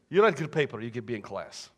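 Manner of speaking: mock Russian professor, matter-of-fact; bad Russian accent. Professor offering student a deal, bad Russian accent. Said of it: "don't like bad Russian accents".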